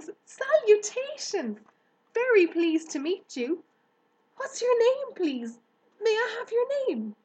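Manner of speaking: high, loud eager pig voice; excited young pig. Acted. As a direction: gush with delight at the new friend. Wilbur's voice held.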